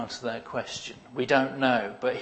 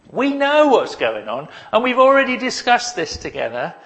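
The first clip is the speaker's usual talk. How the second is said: Jesus pitched higher and louder, chatty; imagined Jesus; 10 semitones higher, 6 dB louder than usual. Retold or imitated. Preacher voicing Jesus talking aside to his Father. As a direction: voice Jesus as a knowing friend. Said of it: "It's like Jesus is saying".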